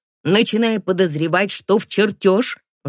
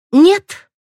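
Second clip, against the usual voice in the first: curt refusal; 12 semitones higher, 9 dB louder than usual. A narrator's reading. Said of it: "коротко отказалась я".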